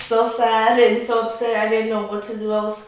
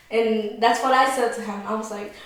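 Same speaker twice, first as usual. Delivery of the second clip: normal talking voice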